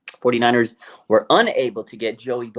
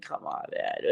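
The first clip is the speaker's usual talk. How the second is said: breathy muttered come on, man; retold exasperated mutter at work; quiet exasperation; 10 semitones lower, 11 dB quieter than usual. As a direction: mutter it under your breath in disbelief. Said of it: "I just was at work going like".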